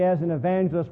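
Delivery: ordinary talk, nothing marked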